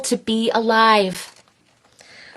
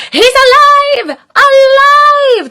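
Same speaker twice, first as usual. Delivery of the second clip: high, loud, slow cry; exclaiming; 8 dB louder, slower than usual. Acted. Little monsters exclaim over their creation, high and loud. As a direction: shout like mad scientists. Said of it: "the little terrors exclaimed".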